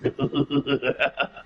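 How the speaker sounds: creepy clown voice with a giggle; Pennywise. Shown as a demonstration. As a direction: lure a child as a sinister clown, slowing at the end.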